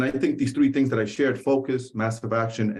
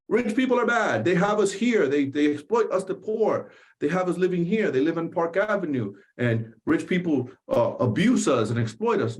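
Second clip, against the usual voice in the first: higher, mock-bitter complaint about the rich; old belief voiced; 4 semitones higher than usual. Retelling what he heard growing up, higher, mock-bitter. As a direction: parrot the grudging voice he grew up hearing. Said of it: "I used to hear growing up".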